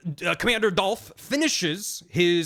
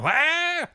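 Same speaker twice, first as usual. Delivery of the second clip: sudden high-pitched "wah"; mock cry; 12 semitones higher than usual. Ocelot as his hand starts going wiggly, high mock wail.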